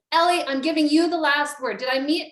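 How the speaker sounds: usual speaking voice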